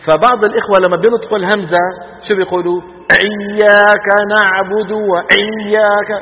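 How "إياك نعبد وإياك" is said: "إياك نعبد" with a retching throaty ʿayn on the hamza, slow and loud; hamza mixed with ʿayn. Shown as a demonstration. Teacher demonstrates the wrong hamza: throaty ʿayn sound. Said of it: "يخلطون صوتها بمين؟ بصوت العين".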